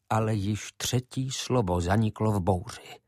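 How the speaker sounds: plain reading voice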